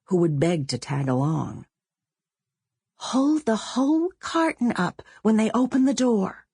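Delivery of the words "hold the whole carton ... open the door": put-on higher girl's voice, giving instructions; bossy girl Sonia. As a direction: coach her friend like a bossy eleven-year-old. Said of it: "Sonia told Willa".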